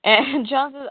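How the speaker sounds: ordinary delivery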